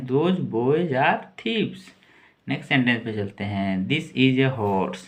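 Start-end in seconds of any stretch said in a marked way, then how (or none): 0.1-1.9 each word slowly and clearly for the class; slow model reading. Shown as a demonstration. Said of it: "यानी वे लड़के चोर हैं". 3.9-5.0 each word slowly and clearly for the class; slow model reading. Shown as a demonstration. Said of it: "इसको बोलेंगे this".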